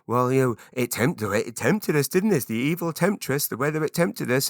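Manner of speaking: lightly hammed-up moaning; mock weather grumble. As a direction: blame the weather with playful indignation. Acted weather small talk.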